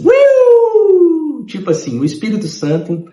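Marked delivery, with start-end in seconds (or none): 0.0-1.4 apito agudo e alto como sirene; sirene de alerta. Retold or imitated. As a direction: soar como um alarme no peito. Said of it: "dentro do meu coração fez assim". (o Espírito Santo apitando como sirene)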